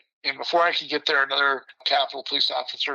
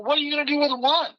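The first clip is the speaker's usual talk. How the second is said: incredulous; 13 semitones higher, faster than usual. Retold or imitated. One officer comes back.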